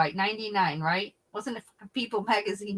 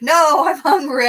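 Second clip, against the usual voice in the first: laughing insistence; 8 semitones higher, 13 dB louder than usual. Retold or imitated. Dad insists on lobster.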